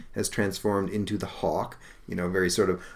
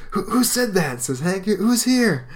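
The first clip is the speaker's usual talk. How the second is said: put-on much higher, loud alarmed young voice; startled; 12 semitones higher, 5 dB louder, slower than usual. Hank hearing a ghostly voice, startled. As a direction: cry out startled.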